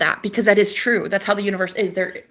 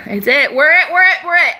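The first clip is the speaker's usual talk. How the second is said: much higher, louder rallying cry; triumphant raised-voice chant; excited shout; 8 semitones higher, 7 dB louder than usual. Real, not acted.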